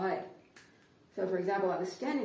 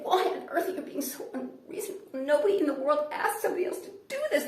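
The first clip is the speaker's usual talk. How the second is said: high-pitched, indignant rant, getting louder; exasperated protest; 7 semitones higher, 5 dB louder than usual. Retold or imitated. Customer starts arguing in English with cashier, exasperated high voice. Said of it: "and I say".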